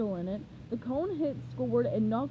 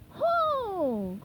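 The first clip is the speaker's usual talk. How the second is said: higher answering ho; cried out; 9 semitones higher than usual. A narrator's reading. Teammates answer Krabs's heave, higher cried ho. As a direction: answer the call in a chant. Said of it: "cried the others".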